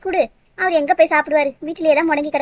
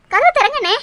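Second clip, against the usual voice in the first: calling out; 8 semitones higher, 3 dB louder than usual. Acted. Calling through locked door.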